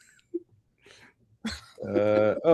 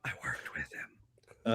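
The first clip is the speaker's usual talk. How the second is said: whisper; 6 semitones lower, 17 dB quieter than usual. Real, not acted. Whispered name-drop aside.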